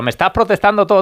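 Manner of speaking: high-pitched imitation of an exasperated referee; retold complaint. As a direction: act out a referee telling a player off. Mimics referee scolding a player.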